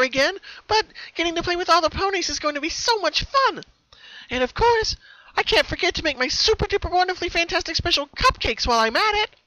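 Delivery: very high, loud squealing joy; very high gleeful squeal; giddy excitement. Acted. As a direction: bounce with delight. Pinkie voiced, eager.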